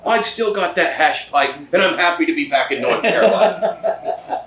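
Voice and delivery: put-on a loose British accent, chuckling through the tale. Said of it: "It's British, okay?".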